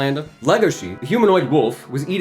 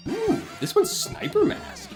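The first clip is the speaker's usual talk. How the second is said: high, slow gleeful coo with a laugh; delighted squeal; slower than usual. Real, not acted. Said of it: "delighted squeal".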